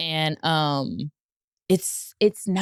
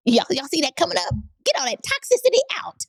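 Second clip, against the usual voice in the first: high, pitched-up comic outburst; mock exorcism cry; 10 semitones higher, faster than usual. Joking to listeners about toxicity coming up, mock exorcism cry. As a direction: shoot the voice way up, playfully. Jokes after apologising for an interruption.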